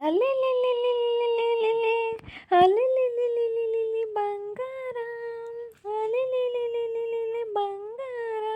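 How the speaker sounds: put-on high, slow drawn-out cooing like soothing a baby; mock baby coo. Husband teasing his sulking wife with high, slow baby cooing. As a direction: coo at her like a crying toddler, teasing. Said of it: "ಅಳು, ಹೆಂಗ್ ಅಳ್ತೀಯ ಅಳು ನೋಡೋಣ".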